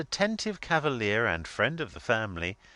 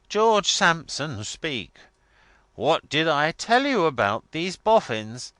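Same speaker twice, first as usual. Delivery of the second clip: loud, higher, imperious demand; sepulchral warning; 6 semitones higher, 8 dB louder than usual. A narrator's reading. Miss Lavvy makes George Sampson speak, sepulchral warning. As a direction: demand it in mother's solemn warning style. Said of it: "sepulchral warning voice founded on her mother's".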